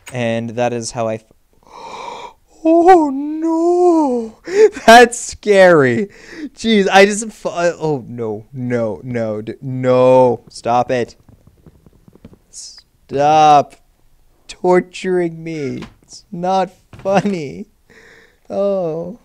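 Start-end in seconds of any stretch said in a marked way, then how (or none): 1.7-2.3 sharp startled gasp; fright gasp. Real, not acted. Startled player gasping at a monster. 2.6-19.2 very high, loud frightened shriek with laughs; scared. Real, not acted. Gamer shrieking as a monster torments them.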